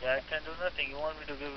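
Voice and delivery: ordinary talk, nothing marked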